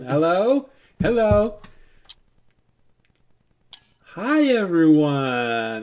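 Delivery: high, questioning hellos, then a slow greeting; intercom announcer. Acted. Gnome on an intercom to the whole garden, tentative greeting. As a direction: test the intercom, then greet them slowly. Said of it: "an intercom throughout the entire garden".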